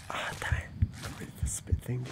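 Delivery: whispered curse; whispered annoyance. Real, not acted. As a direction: hiss it quietly.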